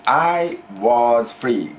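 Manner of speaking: slow, deliberate prompt for the student; slow teacher prompt. Shown as a demonstration. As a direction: spell out each word slowly. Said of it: "Go ahead, please".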